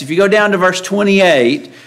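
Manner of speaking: ordinary delivery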